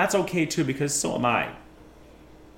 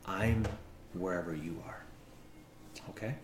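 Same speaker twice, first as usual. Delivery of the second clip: soft reassurance; 12 semitones lower, 16 dB quieter than usual. Acted. Soft reassurance after argument.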